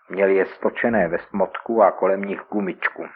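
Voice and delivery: usual speaking voice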